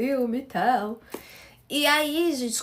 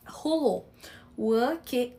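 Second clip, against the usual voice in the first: ordinary talk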